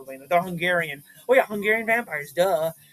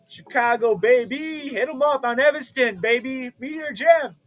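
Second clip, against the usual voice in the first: high, loud hype; big up the record store; high loud excited shout-out; hyped shout-out; 6 semitones higher, 4 dB louder than usual. Real, not acted.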